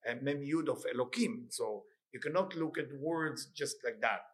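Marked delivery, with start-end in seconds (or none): none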